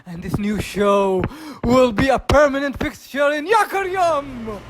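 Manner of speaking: ordinary talk, nothing marked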